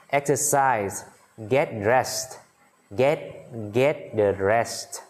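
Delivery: slow, drawn-out vocabulary drill; teacher's dictation pace, pauses between items; listen-and-repeat model reading. Shown as a demonstration. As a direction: say each phrase slowly and clearly for learners. Models phrases for repetition.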